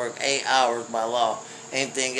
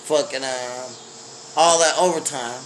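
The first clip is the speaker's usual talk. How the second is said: ordinary talk, nothing marked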